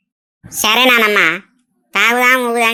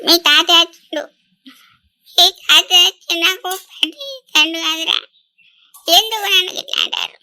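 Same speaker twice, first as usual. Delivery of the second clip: tearful wailing; 3 semitones higher than usual. Acted. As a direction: wail it out through broken sobs. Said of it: "ఏడవకు, ఏడవకు".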